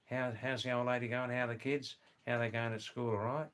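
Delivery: run-together broad Australian small talk; casual Aussie mate's greeting with vowels bent broad; broad Australian accent. Shown as a demonstration. Australian greeting a workmate back on Monday, very broad accent. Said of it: "the other half talk like this".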